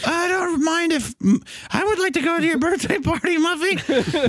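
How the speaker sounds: high timid cartoon kid; high Buster voice.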